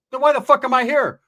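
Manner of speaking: loud, high-pitched exasperated question; indignant protest. Retold or imitated. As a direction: act out snapping back in a quarrel. Acted quarrel, protesting being called over.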